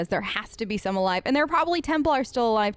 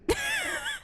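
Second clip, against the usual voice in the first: amused laugh; 6 dB quieter than usual. Real, not acted. Laughs at Shale's remark.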